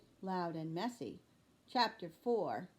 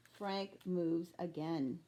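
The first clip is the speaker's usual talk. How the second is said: usual speaking voice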